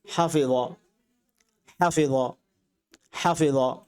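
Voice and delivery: হাফিযা said in Arabic with a clear ظ sound; teacher repeats হাফিযা for students; Arabic pronunciation. Shown as a demonstration. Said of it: "আমি আরবি বলছি". (আরবি উচ্চারণে হাফিযা)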